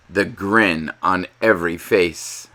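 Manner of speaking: usual speaking voice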